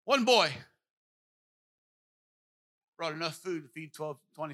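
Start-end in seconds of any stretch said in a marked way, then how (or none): 0.0-0.5 loud opening. Shown as a demonstration. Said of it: "No. No".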